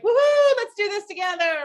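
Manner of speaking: excited woohoo. Retold or imitated. Coach playing an eager team member, excited cheer. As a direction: whoop it up with friends. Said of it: "Which is like".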